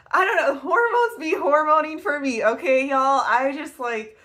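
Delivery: high, loud and emotional, laughing it off; worked up, fighting off real tears; trying not to cry. Real, not acted. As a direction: hold back tears with a laugh. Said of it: "I'm gonna try not to cry".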